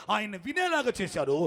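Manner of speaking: usual speaking voice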